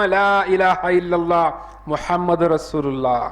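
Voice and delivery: slow, stretched declaration of faith; drawn-out recitation. Acted. Preacher announcing the kalima arriving last, drawn-out recitation. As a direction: draw out each phrase of the kalima.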